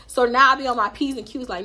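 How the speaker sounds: ordinary delivery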